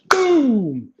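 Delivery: high, sharp "Boom!" crash noise; mimicking the crash heard on the 911 call; imitated crash boom. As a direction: bang it out like the car hitting.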